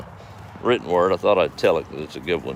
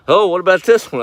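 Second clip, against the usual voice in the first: nervous question; 11 semitones higher, 8 dB louder than usual. Retold or imitated. Nervous guest sees second dog.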